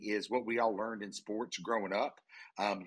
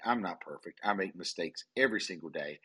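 ordinary talk

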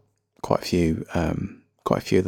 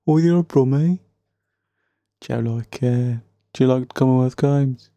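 higher-pitched, hammed-up Brummie accent; bad Birmingham accent; 4 semitones higher than usual. People asking about the Commonwealth Games, in a bad Brummie accent. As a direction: put on a badly faked Brummie accent. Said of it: "That's a really bad Birmingham accent".

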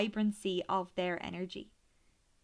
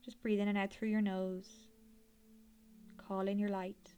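airy breathy mystical healer. Acted. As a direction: gently guide them inward like a healer. Leads a guided meditation.

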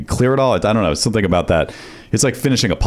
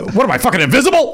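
high, quick indignant outburst; mock yell; 3 dB louder, faster than usual. Co-host imagining the frustrated host's outburst at the faucet, mock yell. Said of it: "Do you sometimes yell".

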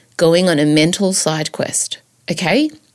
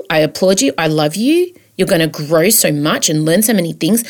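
ordinary talk

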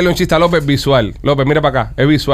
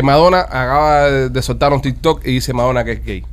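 ordinary talk, nothing marked

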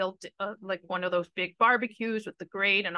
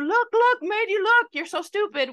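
put-on high, loud taunting kid brother; pushy brother voice; 11 semitones higher, 7 dB louder than usual. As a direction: yell it like a pestering little brother. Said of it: "he always used to do".

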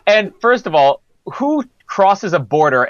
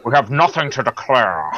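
low Scottish film-star impression; Sean Connery; 5 semitones lower than usual. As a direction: deliver it lower, as a suave spy. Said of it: "Let's go back to Connery".

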